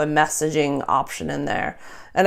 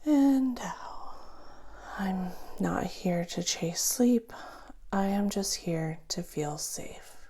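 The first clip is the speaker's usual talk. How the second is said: soft whispered breathing cues; guided breathing; 14 dB quieter, slower than usual. Acted. Coach guides listener through breaths, whispered.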